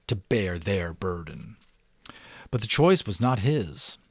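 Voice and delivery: expressive read-aloud narration; story narration. A narrator's reading. Narrator reading The Giver to subscribers, expressive storytelling.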